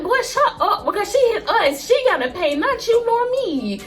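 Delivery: high-pitched, emphatic telling-off; exasperated scolding. Retold or imitated. Sister setting her worried brother straight, exasperated scolding. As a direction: scold him high and insistent.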